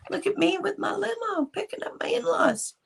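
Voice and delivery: very high, breathy sneering brag; mocking the arrogant son-in-law in a high breathy voice; mocking big shot. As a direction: sneer it like a smug kid. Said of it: "like he's some big shot guy going".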